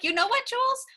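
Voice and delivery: imitating Rue squaring up, pitched high; defiant Rue. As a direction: wind up for a brutal put-down. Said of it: "No, she's like".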